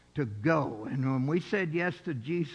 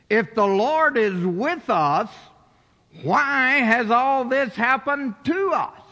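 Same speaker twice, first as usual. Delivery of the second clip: slow, loud, aggrieved demand; angry question; 5 semitones higher, 6 dB louder, slower than usual. Acted. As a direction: hurl the question at God, bitter. Gideon's angry question voiced.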